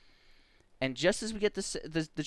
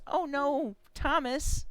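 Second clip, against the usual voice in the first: put-on high little-kid voice; high-pitched, drawn-out mock dismay; child's dismay; 13 semitones higher, much slower than usual. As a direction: imitate a small child's dramatic worry.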